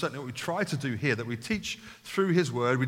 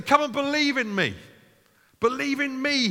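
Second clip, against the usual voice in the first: much higher, louder call to the crowd; preacher voicing Jesus calling people to believe; loud proclamation as Jesus; 10 semitones higher, 4 dB louder than usual. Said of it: "Why did he say it in a loud voice?".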